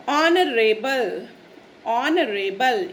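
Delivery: ऑनरेबल said very slowly, no h, opening on O; silent h. Shown as a demonstration. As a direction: stretch out ऑनरेबल for learners, O first. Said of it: "H जो है वो silent होता है". (ऑनरेबल बहुत धीरे, H silent)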